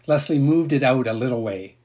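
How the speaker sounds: usual speaking voice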